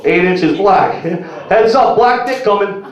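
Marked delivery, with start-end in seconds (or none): 1.5-2.8 high, louder heads-up shout; mock warning call. Comedian warns front row, hammed-up call. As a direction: toss a playful warning to the crowd.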